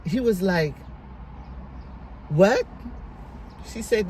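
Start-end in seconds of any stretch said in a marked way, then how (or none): 2.3-2.6 louder, quick rising question; retold surprise. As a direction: act out a startled one-word reply. Retold phone call, uncle's disbelief.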